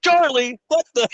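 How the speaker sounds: high, strained cry of sudden outrage; mock triggered outburst. Co-host imitates veteran triggered by boss's name, mock outburst. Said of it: "and he's like".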